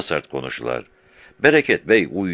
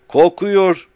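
shouting; 9 dB louder than usual. A narrator's reading. The Bey keeps shouting.

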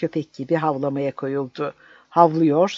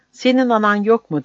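high, loud whimpering plea; whining plea; 7 semitones higher, 6 dB louder than usual. Acted. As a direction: whine it like a begging captive. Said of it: "diye sızlandı". (sızlanarak, yüksek ve yalvaran)

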